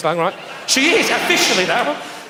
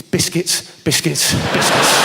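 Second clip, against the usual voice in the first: Kirsty Allsopp impression; 4 semitones lower, much slower than usual. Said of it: "what she's actually thinking is".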